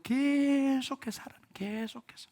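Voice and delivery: "계속해서" with its first syllable drawn out long and high; stretched emphasis. Real, not acted. Preacher urging them on to the end, drawn-out stress on "계속해서". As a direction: stretch the start of "계속해서" to show endless persistence.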